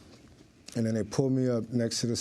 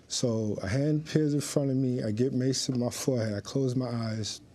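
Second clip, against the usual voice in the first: normal talking voice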